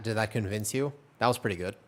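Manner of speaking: normal talking voice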